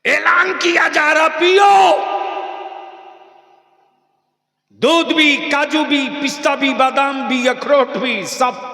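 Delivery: high, loud hawker-style announcement; mock announcer's call. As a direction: cry it out like a street announcer. (ऊँची आवाज़ में ऐलान करने वाले की नकल)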